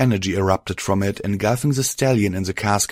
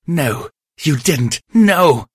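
slow build to a loud, high howl; howling with rage; 7 semitones higher, 5 dB louder, slower than usual. Acted. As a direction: rage as she is dragged away. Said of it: "She howled with rage".

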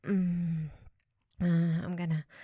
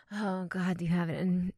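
ordinary delivery

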